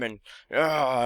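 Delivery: ordinary talk, nothing marked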